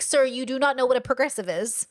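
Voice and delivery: ordinary talk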